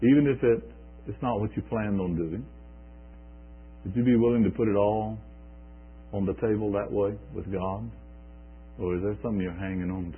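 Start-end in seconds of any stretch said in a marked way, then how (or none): none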